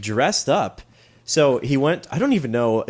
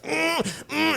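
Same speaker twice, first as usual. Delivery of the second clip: bee mascot; 6 dB quieter than usual. Pretend. Bee mascot voiced in commercial.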